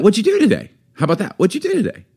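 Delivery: high, quick, bubbly asking; hammed-up cheery interest; perky question. Shown as a demonstration. Demo of nicer ways to ask.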